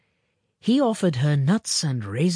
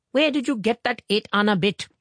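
higher-pitched mother pouncing with a question; pouncing demand; 8 semitones higher, 4 dB louder than usual. A narrator's reading. Mother catching sight of the coin, pouncing demand. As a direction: pounce on the child, voice raised in pitch. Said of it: "had pounced on the child with".

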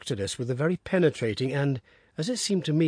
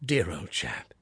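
soft breathy whisper, lower; tender whisper; 7 semitones lower, 4 dB quieter, slower than usual. A narrator's reading. Father soothing his clinging son, tender whisper. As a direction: murmur it fondly to the boy. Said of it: "with a very tender hand".

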